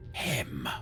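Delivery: ordinary talk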